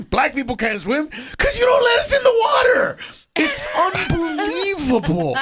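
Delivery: high-pitched mock outrage; high, worked-up incredulous protest; exasperated retort. Acted retort at the pool.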